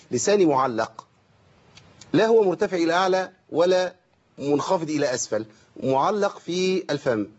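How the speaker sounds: usual speaking voice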